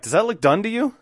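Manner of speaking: high-pitched, disbelieving rhetorical question; incredulous questioning. Retold or imitated. Boss doubting a finished hitch, incredulous. Bosses question his bad work.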